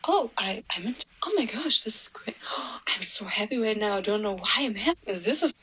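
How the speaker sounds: fast, hushed, genuinely thrilled whisper; breathless whisper. Retold or imitated. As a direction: whisper your joy as if overwhelmed. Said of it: "She was like".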